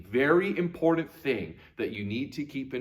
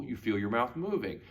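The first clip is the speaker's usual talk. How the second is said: ordinary talk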